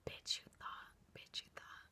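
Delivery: sighed then whispered, mocking. Real, not acted. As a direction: hiss it softly as a taunt. Mocking aside to audience.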